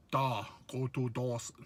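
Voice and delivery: put-on clumsy stab at a Russian accent; mock Russian accent. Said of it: "like a Ru".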